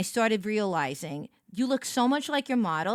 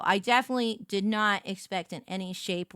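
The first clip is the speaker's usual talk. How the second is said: usual speaking voice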